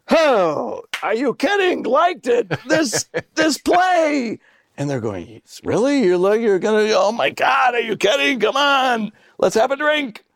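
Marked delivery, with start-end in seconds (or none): 0.1-4.4 high, gushing, hammed-up delight; mock gushing. Mocking delight about the play. 5.6-10.2 mock excited shouting. Imitating the gushing backstage crowd.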